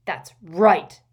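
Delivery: loud, triumphant roar. A narrator's reading. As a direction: roar the confirmation back at him. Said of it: "roared Gaunt".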